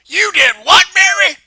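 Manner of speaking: loud, high disbelieving yell; outraged shout. Acted. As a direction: explode at the kid. Mom outraged.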